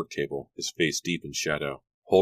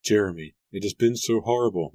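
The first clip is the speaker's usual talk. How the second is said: higher, upset outburst; distressed cry; 4 semitones higher, 3 dB louder than usual. A narrator's reading. Mary pours out her troubles to Jeremy, distressed cry. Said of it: "Mary cried".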